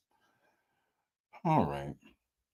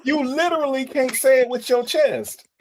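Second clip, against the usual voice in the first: higher, emphatic teasing jab; heightened teasing; 6 semitones higher than usual. Real, not acted. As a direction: push the tease up high. Host repeats the tease, heightened.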